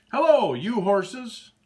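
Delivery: high, loud, slow hello; greeting. Acted. Moose greets the horses, high and slow. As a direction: call out a big slow greeting.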